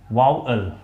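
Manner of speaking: वाउअल as "VAU-ul", falling on a light "ul"; softened second syllable. Shown as a demonstration. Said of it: "धीमे से pronounce करते हैं".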